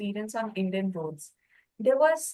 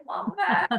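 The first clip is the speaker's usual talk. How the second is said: mock dismay; 9 semitones higher, 3 dB louder than usual. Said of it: "I was like".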